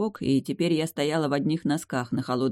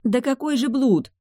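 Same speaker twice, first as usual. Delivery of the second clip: high, louder flustered outrage; much higher indignant What adultery; indignant protest; 9 semitones higher, 5 dB louder than usual. Acted. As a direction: protest and justify at once. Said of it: "попыталась возмутиться и одновременно оправдаться я".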